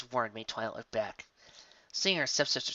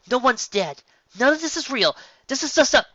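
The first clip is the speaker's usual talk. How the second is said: urgent Cadance; 13 semitones higher, 10 dB louder than usual. A narrator's reading. Cadance calms Twilight.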